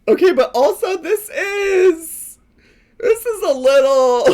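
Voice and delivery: high squeal of laughter through the words; squealing, laughing disbelief. Real, not acted. Laughing at the Lucky Charms.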